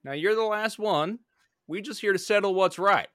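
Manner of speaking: smooth-talking debt collector; Western gambler voice. Acted. Player voices gambler character.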